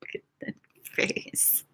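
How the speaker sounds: unvoiced amused whisper; hushed look at that face. Real, not acted. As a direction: lean in and whisper it. Whispered aside.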